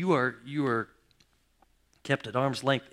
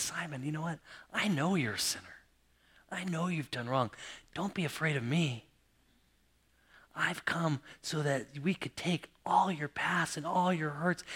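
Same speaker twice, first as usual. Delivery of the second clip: gentle reassurance; 2 semitones higher, 4 dB quieter than usual. Retold or imitated. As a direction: speak hope to a guilty man. Jesus speaks to Simon.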